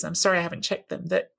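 ordinary delivery

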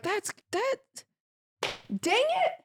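high squeaky mock dismay; squeaky frustration. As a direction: squeak it out like a cartoon. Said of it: "I'm like".

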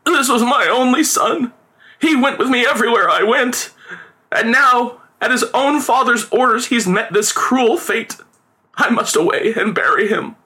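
high, keening father's lament; distraught. A narrator's reading. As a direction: mourn your son, pitched high and tearful. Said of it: "The distraught father".

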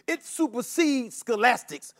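normal talking voice

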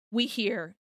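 normal talking voice

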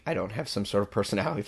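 normal talking voice

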